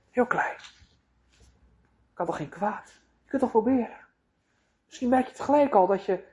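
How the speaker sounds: serpent-like coaxing to take a bite; tempting devil. Acted. Devil tempts her toward the fruit.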